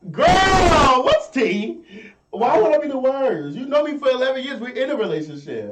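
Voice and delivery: high, loud, indignant shriek; outraged disbelief. Real, not acted. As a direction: squeal in mock outrage. Mock outrage at being picked.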